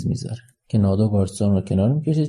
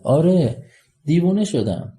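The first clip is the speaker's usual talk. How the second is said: openly declaring it, voice lifted; raised voice; 7 semitones higher than usual. Acted. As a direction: call it out, pitched well up. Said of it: "با صدای بلند".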